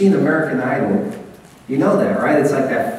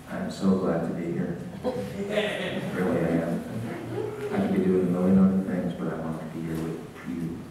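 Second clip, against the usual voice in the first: low, soft, unconvincing welcome; a lukewarm welcome to a newcomer, lower and softer; unenthused; 8 semitones lower, 8 dB quieter than usual. Shown as a demonstration. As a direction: say it like you don't mean it. Demo of an unenthused greeting.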